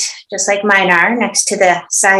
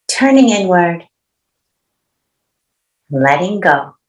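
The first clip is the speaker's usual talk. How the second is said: calm, unhurried meditation guidance with long pauses; spacious guiding voice, pausing between instructions; guided meditation. Acted. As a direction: settle them gently into stillness, phrase by phrase. Leads opening meditation.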